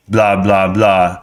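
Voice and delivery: imitating a slow, monotone talker; monotone impression. Mimicking another YouTuber for Pops, low monotone. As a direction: drone it slowly, a little low, in mock monotone. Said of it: "like, real monotone and low".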